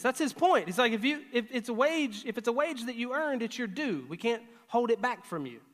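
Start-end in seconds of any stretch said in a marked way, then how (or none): none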